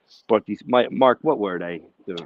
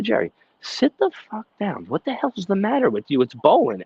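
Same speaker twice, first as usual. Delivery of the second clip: high, exasperated telling-off; mock angry scolding; 10 semitones higher, 3 dB louder than usual. Host retelling how he scolded Jerry at the bowling alley, exasperated. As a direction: snap at a rowdy friend to sit down. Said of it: "And I said".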